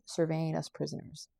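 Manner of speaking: ordinary delivery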